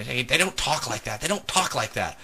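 lightly voiced cross wife; imitated annoyed wife. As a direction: mimic a wife getting fed up. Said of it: "my wife gets angry and angry".

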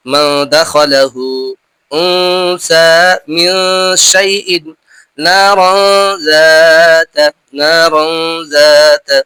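slow, drawn-out tajweed recitation; slow Quranic recitation with nasal ghunna. Shown as a demonstration. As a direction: recite each word with ghunna, stretched.

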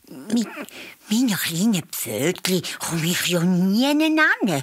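a hint of a little hare musing; little hare thinking. A narrator's reading. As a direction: think aloud as a reluctant small hare. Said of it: "Der hat gedacht".

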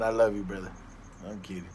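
usual speaking voice

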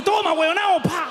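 phone gets revenge by hitting your face, taunting; gloating phone. Acted. Phone gloats after falling on its owner, taunting.

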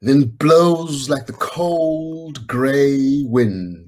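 very slow, stretched like blowing wind; long, slow windy delivery; drawn-out wind. Acted. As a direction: blow the words out slowly.

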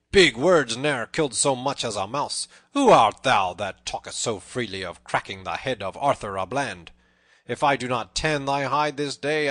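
loud, indignant boasting; in a fume. A narrator's reading. Tanner warns Little John before the fight, fuming. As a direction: threaten back, worked up. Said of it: "talked himself into a fume".

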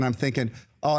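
ordinary talk, nothing marked

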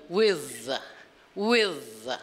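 with said with a soft blown TH, not F or D. Shown as a demonstration. Teacher showing the soft TH in with to a student. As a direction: blow a little air through the TH in with. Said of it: "esse é o T H brando".

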